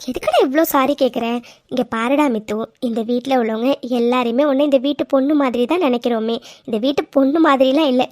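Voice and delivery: usual speaking voice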